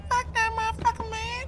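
very high falsetto cursing; squeaky put-on voice. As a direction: repeat the curse in a comic squeak. Repeats curse in squeaky put-on voice.

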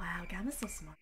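soft whispered aside. Real, not acted. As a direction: whisper it to the chat.

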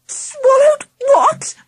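high, drawn-out shriek of alarm; frantic cry. A narrator's reading. Auntie Sally hearing about the tooth, frantic cry. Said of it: "cried Auntie Sally frantically".

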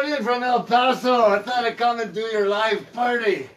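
loud, high hammed-up Mexican cowboy accent; brash Mexican accent cowboy greeting. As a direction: play a singing cowboy in a mock Mexican accent. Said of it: "Better drop that accent pretty quickly".

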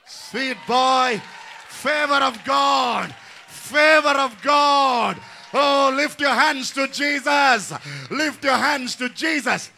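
loud, slow, soaring cry of praise; exultant shout. Real, not acted. Pastor proclaiming to the crowd, exultant shout. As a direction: shout the favor of God out slow.

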